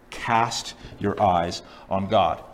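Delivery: very slow and low, word by word; solemn, drawn-out directive; emphatic repeat. Acted. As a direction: stretch out each word with weight.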